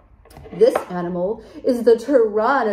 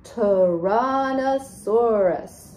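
Tyrannosaurus stretched slowly into five beats; Tyrannosaurus, slowly split for counting; syllable by syllable; much slower than usual. Shown as a demonstration. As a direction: draw out each syllable of Tyrannosaurus evenly. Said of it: "How many syllables does the".

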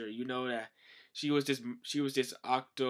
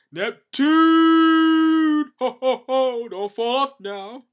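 booming, high-pitched drawn-out bellow; loud shouting old king; 14 semitones higher, 17 dB louder, much slower than usual. Acted. King calling out from atop his whale, loud high shout. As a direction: holler it out at full volume, high and stretched.

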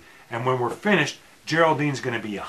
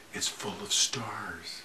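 hushed awe; 6 semitones lower, 12 dB quieter, slower than usual. Pretend. Quoting film, awestruck.

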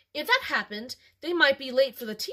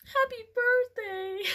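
put-on higher, slow, teary little-girl voice; sniffly; 11 semitones higher than usual. Shown as a demonstration. Reader corrects the line into a teary sniffle. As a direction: redo it as a sniffling toddler. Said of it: "I guess that'd be more like".

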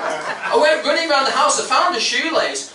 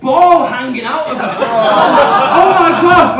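ordinary talk

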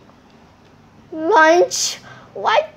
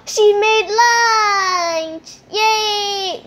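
excited gasp; 6 semitones higher, 4 dB louder, much slower than usual. Pretend. Thrilled about pretend lunch.

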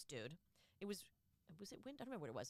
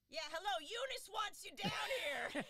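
high, slow, called out; 11 semitones higher than usual. Retold or imitated. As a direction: call it out high and slow across the room. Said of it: "and he goes".

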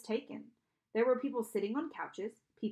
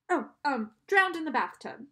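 loud, higher, flustered improvising; flustered liar; 5 semitones higher, 8 dB louder than usual. A narrator's reading. Grover invents a death for Charon, flustered. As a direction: blurt out a made-up excuse. Improvised lie after a nudge.

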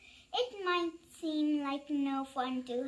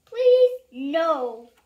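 begging; 10 dB louder than usual. Acted. Reader begging to stop reading, loud pleading. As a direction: beg loudly.

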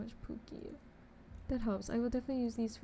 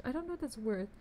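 ordinary talk, nothing marked

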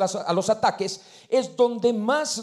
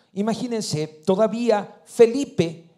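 ordinary talk

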